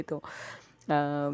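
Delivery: ordinary talk, nothing marked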